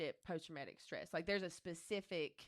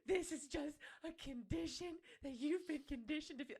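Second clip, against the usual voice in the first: higher, crying voice telling herself the truth; tearful self-talk; 9 semitones higher than usual. Retold or imitated. Telling herself the truth while crying alone on the couch. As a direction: plead with yourself through tears. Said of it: "I'm sitting here going".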